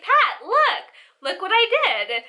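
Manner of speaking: proudly calling grandma over, hammed-up; eager child. Sister showing off to grandma.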